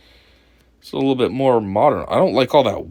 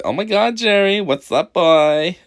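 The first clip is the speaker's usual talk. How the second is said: a light, higher flamboyant greeting; mock greeting; 6 semitones higher, slower than usual. Imitating the man greeting Jerry with mild flair. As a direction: greet him with a bit of flair.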